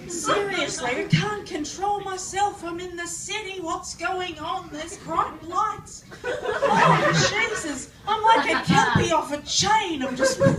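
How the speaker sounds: high, overexcited country visitor; going mental. Acted. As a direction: act wildly overstimulated by the big city. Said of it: "I go fucking mental".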